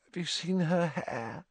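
high, soft, dreamy swooning; breathless adoration, lost in a daydream; lovesick rapture. A narrator's reading. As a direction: rhapsodise slowly, lingering on the later words. Lovesick Ron rhapsodises about Romilda.

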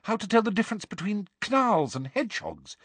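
high-pitched, insistent coaching; high, pleading schoolgirl; earnest. A narrator's reading. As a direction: press the advice on him earnestly. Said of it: "said Hermione earnestly".

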